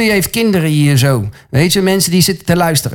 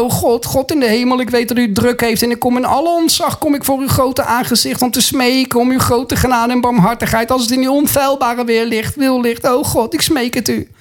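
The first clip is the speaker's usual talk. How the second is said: higher-pitched, pleading imitation of prayer; mock grovelling prayer; 4 semitones higher than usual. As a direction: pray in exaggerated supplication, begging God without let-up. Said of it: "Je hoeft niet zo te bidden". (overdreven onderdanig smeekgebed)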